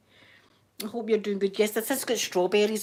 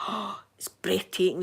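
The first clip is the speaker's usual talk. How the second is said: gasping, breathy awe; hushed awe after a gasp; awed; 3 dB quieter than usual. Retold or imitated. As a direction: catch your breath in wonder, half whispered. Recalls awe at huge rocket.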